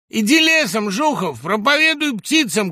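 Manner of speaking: high, loud jeer; mocking shout. A narrator's reading. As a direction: send him packing at full voice. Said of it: "крикнул Гусев". (насмешливый крик)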